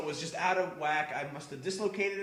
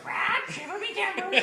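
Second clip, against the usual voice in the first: much higher, squawky parrot voice through a laugh; squawking pirate parrot; 13 semitones higher, 4 dB louder than usual. Pretend. Imagining the surly coworker's parrot at home, squawking parrot voice. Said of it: "there's a parrot that goes".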